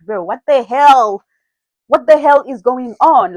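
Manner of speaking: slow, loud, exasperated "what the hell"; retold angry confrontation. To the guy who lied, furious and loud. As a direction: demand answers, fed up. Calling the guy after girl's call.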